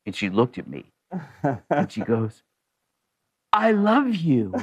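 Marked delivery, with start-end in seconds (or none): 3.5-4.6 slow, higher, gushing imitation; sudden adoration. Woman suddenly recognizes the driver she screamed at, adoring. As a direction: draw it out adoringly.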